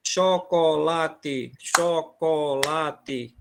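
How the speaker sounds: chocolate drawn out, syllable by syllable; very slow spelled-out word. Shown as a demonstration. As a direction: stretch chocolate, each syllable on its beat. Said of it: "Cada sílaba num canto".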